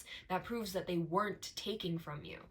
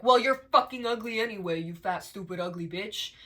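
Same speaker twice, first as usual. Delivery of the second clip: loud, sneering catcaller; mock catcaller insult, loud then dropping; 10 dB louder than usual. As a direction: spit it out like a rude man on the street. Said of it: "And then he's like".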